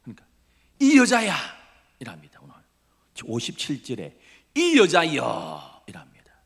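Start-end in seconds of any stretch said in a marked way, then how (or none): 0.8-1.3 loud, high outburst of denial; indignant retort. Acted. Peter denying to the servant girl, indignant retort. 4.5-5.8 loud, drawn-out protest; indignant retort. Acted. Peter denying to the servant girl, drawn-out indignant retort.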